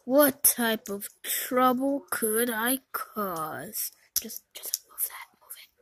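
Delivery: unvoiced villain whisper; scheming in a whisper.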